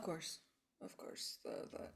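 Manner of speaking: soft unvoiced whisper. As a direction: whisper it conspiratorially. Secretive reply in character.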